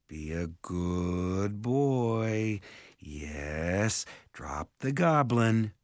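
slow, soothing animal-handler voice; gentle coaxing. A narrator's reading. Simon coaxes the griffin.

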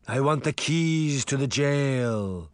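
slow, drawn-out phantom voice; ghostly demand. A narrator's reading. Sabrina as the Horseman demanding from Crane, ghostly. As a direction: intone it slowly like a spectre.

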